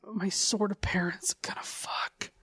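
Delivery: put-on breathy whisper of a peeking kid; creepy whisper. Co-host whispers as the creepy kid watching his parents.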